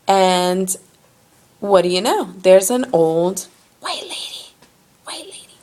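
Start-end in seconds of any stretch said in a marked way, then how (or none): 5.0-5.6 hushed, high whisper; whispered aside. Real, not acted. Storyteller quietly repeats who was waiting there, whispered.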